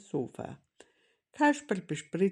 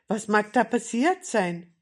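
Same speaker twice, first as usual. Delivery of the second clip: louder, higher worried question; alarmed; 4 semitones higher, 7 dB louder than usual. A narrator's reading. Kasperl worrying about Grandmother, alarmed. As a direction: wonder urgently what is wrong.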